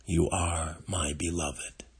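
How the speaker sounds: ordinary talk, nothing marked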